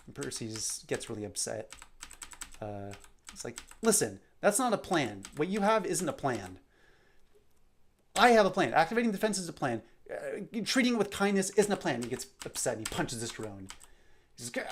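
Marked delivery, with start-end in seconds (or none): 3.8-12.0 higher, louder scolding; frustrated rant. Acted. Percy angrily rejects Ash's peaceful idea, frustrated rant. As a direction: lecture her, losing patience. Percy gets really upset.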